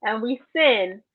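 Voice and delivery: usual speaking voice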